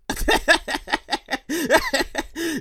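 soft, extended chuckle; wheezy laugh. Real, not acted. Host amused by segment title, soft laughter. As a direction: laugh softly at your own joke.

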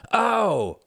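high, bright "Oh!" of realization; sudden realization. A narrator's reading. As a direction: gasp it out as the penny drops. Reginald realizes the answer.

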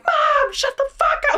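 very high mock yell; kid screaming. Remembering being a kid yelling at mom, high scream. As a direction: shriek it like an exasperated child. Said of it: "screaming, like".

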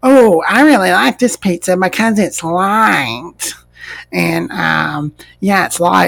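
mock a forced compliment; fake-polite diner. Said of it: "eating it like".

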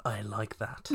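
breathy sultry whisper; seductive whisper. Acted. Seductive fanfic line.

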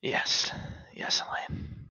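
breathy whispered yes. Acted. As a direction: whisper it close and tender. Tender whispered reconciliation.

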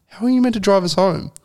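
high, shocked disbelief at the driver; asking the drunk driver how he'll get them home, incredulous; incredulous question. Retold or imitated.

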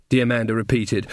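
ordinary delivery, nothing marked

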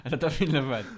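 said through laughter; laughing. Real, not acted. Teasing friend repeats the line, laughing.

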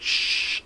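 an unvoiced shush. Friend hushing everyone in the shed, unvoiced shush. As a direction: hiss a quick shush to silence the room. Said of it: "he's telling us to be quiet".

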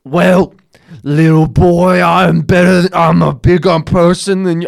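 loud, high, slurred caricature; hammed-up addled boasting; gibberish crackhead imitation. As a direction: garble it into belligerent nonsense. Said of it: "kinda my gibberish interpretation of that".

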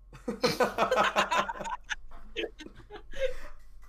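loud, high burst of laughter; big real laugh. Real, not acted. Co-host cracking up after asking about British people's teeth, loud laugh. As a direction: crack up loudly and helplessly. Laughs hard at own joke.

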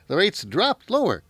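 usual speaking voice

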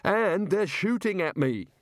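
high, drawn-out indignant wail; panicky protest. Acted. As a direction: protest shrilly, aggrieved and frightened. Wizard complaining under fire.

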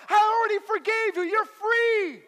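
very high, full-volume insistence; acted exasperated shout. God to believer who keeps apologizing, exasperated shout.

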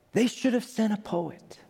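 high, hushed wonder; awed hush. Retold or imitated. Scientist overwhelmed by the galaxy, high awed hush. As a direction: let the words fail her. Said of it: "she says".

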